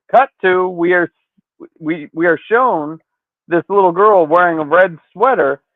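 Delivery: ordinary talk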